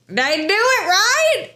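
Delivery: put-on high-pitched kid seeking approval; eager child. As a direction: imitate an excited child asking to be praised. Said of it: "they may just be like".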